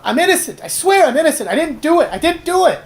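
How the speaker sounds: high, loud gnome protesting; frantic calling out. Acted. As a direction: shriek it out in a high, pleading gnome voice. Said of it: "the gnome calls out".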